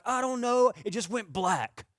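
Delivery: high, dazed excuse; imitating brother. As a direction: play the big brother shrugging it off. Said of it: "he'd be like".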